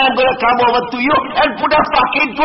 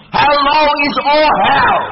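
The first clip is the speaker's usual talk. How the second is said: usual speaking voice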